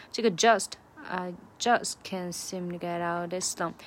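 just and slump punched, the rest light; stress on just and slump. Shown as a demonstration. Teacher showing learners the stressed words, just and slump punched. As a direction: hit just and slump, glide over the rest. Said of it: "这个just和slump算是重读的哈".

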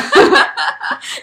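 loud high laugh; high burst of laughter. Real, not acted. Laughing at her friend calling herself shallow. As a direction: laugh hard at the joke. Reacting to self-mockery.

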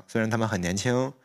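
normal talking voice

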